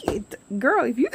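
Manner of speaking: high squeal of protest; squealing while tickled; being tickled. Real, not acted. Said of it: "I am ticklish".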